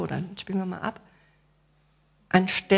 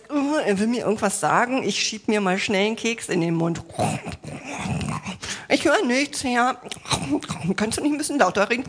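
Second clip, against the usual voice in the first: higher, hammed-up innocent whine, cookie in mouth; mock ignorant listener; 3 semitones higher than usual. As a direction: play the one who pretends not to hear. Mocking a listener who won't hear.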